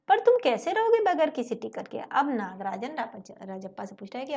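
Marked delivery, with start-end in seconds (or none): 0.1-2.0 astonished. Acted. Boy asks, astonished.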